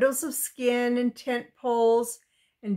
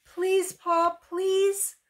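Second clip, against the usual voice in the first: high, drawn-out pleading; pitched-up child's plea; begging; 8 semitones higher, much slower than usual. A narrator's reading. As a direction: beg like a little girl pleading with her father. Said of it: "Laura begged".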